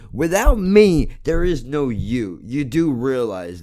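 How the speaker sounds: loud, slow furious yell; angry shout. Real, not acted. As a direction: bellow it at YouTube. Ranting at YouTube.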